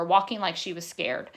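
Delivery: plain reading voice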